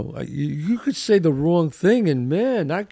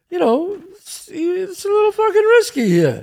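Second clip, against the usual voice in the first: much higher, louder put-on woman's voice; mock girl voice; 14 semitones higher, 5 dB louder than usual. Man voicing a woman worried about a date. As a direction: play the nervous girl, pitched way up. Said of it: "the girl says".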